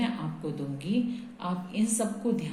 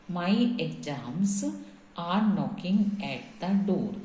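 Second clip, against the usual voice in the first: slow dictation; much slower than usual. Shown as a demonstration. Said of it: "ये मैं आपको बता रही हूँ".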